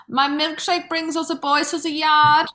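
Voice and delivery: put-on sung in a thick German accent. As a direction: belt it with a heavy German accent. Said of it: "in my thick, thick German accent".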